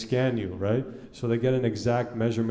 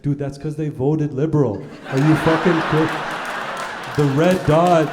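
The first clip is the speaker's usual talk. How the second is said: louder, half-laughing fake outrage; mock incredulous, laughing; 3 semitones higher, 5 dB louder than usual. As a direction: push it like you're winding him up. Mock-arguing with friend, laughing.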